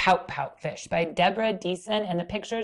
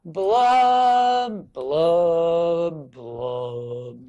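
falling blub; 3 semitones lower, 6 dB louder, much slower than usual. Shown as a demonstration. Teacher leading kids in the droopy blub. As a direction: drop each blub down the scale. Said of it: "the part I need your help with".

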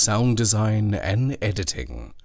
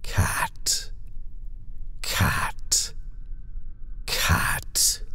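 cold, toneless whisper, softer; cruel flat whisper; 3 semitones lower, 4 dB quieter, much slower than usual. A narrator's reading. Nightmare creature hissing at the child, cruel toneless whisper. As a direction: breathe each cat out without voice. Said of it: "in a voice cruel and flat".

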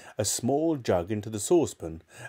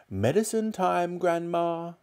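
put-on a boy calling out, lightly higher; 8 semitones higher than usual. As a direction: call sweetly to the next room. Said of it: "he called out".

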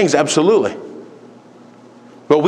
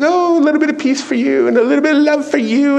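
sugary nice-guy voice, pitched way up; mock Jesus; 10 semitones higher than usual. Preacher mocks the idea of a nice-guy Jesus, sweetly high. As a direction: imitate a harmless, sweet do-gooder in a high voice. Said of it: "he was just the nicest guy".

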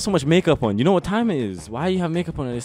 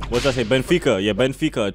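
ordinary talk, nothing marked

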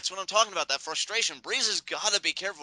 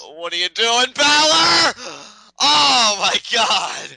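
high, loud disbelieving yell; stunned shout; 8 semitones higher, 8 dB louder, much slower than usual. Real, not acted. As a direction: cry out at a crazy dive.

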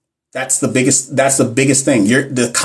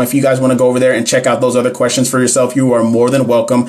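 normal talking voice

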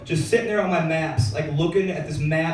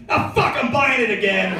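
1 exasperated giving in; 6 semitones higher, 3 dB louder than usual. Retold or imitated. Resigned shopper.

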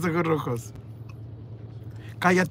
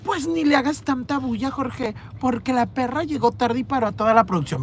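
higher, catty tattling voice; mock Arturo; 7 semitones higher than usual. Arturo snitching to Jorge, high and catty. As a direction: tattle on her like a snide coworker. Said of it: "le dijo".

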